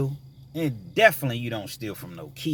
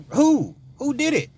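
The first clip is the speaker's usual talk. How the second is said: alarmed questioning; 13 semitones higher, 5 dB louder than usual. Retold or imitated. Urgent demand after theft news.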